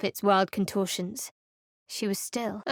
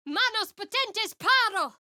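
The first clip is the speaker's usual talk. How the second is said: high, forceful spell cry; spell incantation; 11 semitones higher than usual. Acted. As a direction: summon the hands with power. Casting a spell in combat.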